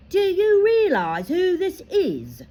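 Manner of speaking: high, slow, loud and commanding; proud centaur. A narrator's reading. Firenze defending Harry to Bane, high and loud. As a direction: stand your ground with dignity. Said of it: "said Firenze".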